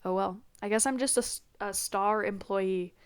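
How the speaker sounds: normal talking voice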